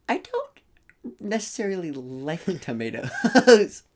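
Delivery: slow, laughing through words. Real, not acted. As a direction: giggle through it.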